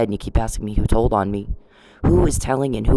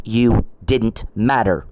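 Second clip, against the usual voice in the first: slow, each word weighed: "You didn't matter"; slow emphatic put-down; slower than usual. Real, not acted. To Mister Perry, a slow emphatic dismissal.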